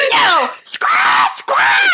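shouted, swooping high-pitched screech; mock creature squawk. Devil pig runs off to scare the Brits, mock squawking. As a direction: squawk like a startled beast.

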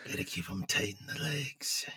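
hushed, unvoiced creepy whisper. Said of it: "I can hit that really creepy voice".

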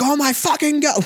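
high, stunned wrestling commentator cry; Jim Ross call. Retold or imitated. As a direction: play the awestruck ringside commentator. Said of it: "He'd be like".

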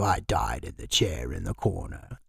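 put-on low, hushed ghostly old man; creepy old-man impression; old man's voice. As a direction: imitate a dead old man, deep and soft. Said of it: "it's still in the old man voice".

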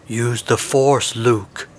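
slow, drawn-out mentor voice; Ben Kenobi. A narrator's reading. Hermit guiding the pilot, slow solemn counsel. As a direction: intone it slowly, weighting each word. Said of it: "Ben Kenobi's voice".

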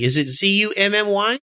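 letters spelled one by one; spelling it out. Shown as a demonstration. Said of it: "So spell that for me".

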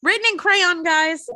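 high, loud laughing delivery; cracking up at the crayon detail; laughing while telling friends. Real, not acted.

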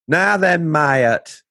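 high, loud-ish Lincolnshire greeting; broad Lincolnshire dialect, vowels stretched; Lincolnshire accent. Shown as a demonstration. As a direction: put on a thick Lincolnshire accent. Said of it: "people speak like that".

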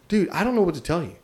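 telling the salesman he's out of luck, exasperated; exasperated dude. Retold or imitated. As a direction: shrug him off with a tossed-off dude. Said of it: "I didn't say "dude".

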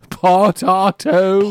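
loud, slow croaking potato; Gollum voice. Pretend. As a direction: drag the word out like a creature. Laughing Gollum imitation.

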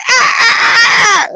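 high squealing dolphin cry; dolphin squeak. Retold or imitated. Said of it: "the dolphin would, of course, go".

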